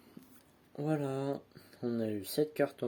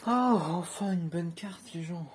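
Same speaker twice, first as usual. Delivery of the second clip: delighted; 6 semitones higher, 4 dB louder, slower than usual. Real, not acted. Finally a good card.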